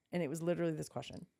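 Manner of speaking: normal talking voice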